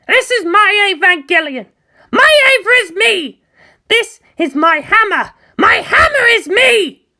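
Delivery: proud, defiant outburst, building to a shout; fiery girl pilot. Acted. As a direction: Asuka brushing off fancy names, rising to a yell. Asuka voiced as character.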